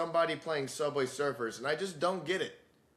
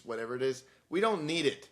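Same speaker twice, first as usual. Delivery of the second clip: normal talking voice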